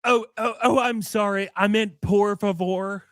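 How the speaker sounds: high, apologetic mock customer; mock sheepish customer. Co-host mocking the meek customer in a high voice. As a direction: squeak out a sorry like a timid customer. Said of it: "mock customer apology".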